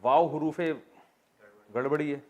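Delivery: normal talking voice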